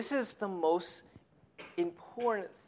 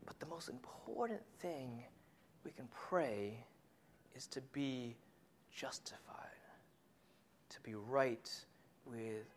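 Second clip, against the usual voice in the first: soft, hushed and much lower; 6 semitones lower, 16 dB quieter than usual. Acted. Preacher hushing the most important point to listeners. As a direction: draw the congregation in with a hush.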